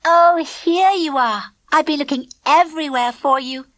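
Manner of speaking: drip sweetness as she barges in; Soppy Elaine interrupting the friends, sugary. A narrator's reading. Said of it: "a sugary voice broke in".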